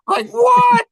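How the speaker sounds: high, loud disbelieving yelp; hammed-up shout of disbelief; incredulous outburst. Reacting to Tom admitting he has no idea, incredulous. Stunned by Tom's clueless reply.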